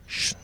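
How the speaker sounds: soft, voiceless shush; hushing shh. Retold or imitated. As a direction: discreetly shush a friend. Shushing friend as lady passes.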